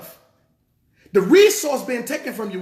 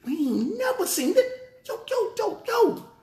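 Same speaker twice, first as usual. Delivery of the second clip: startled disciples; 12 semitones higher, 3 dB quieter than usual. Retold or imitated. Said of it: "They're like".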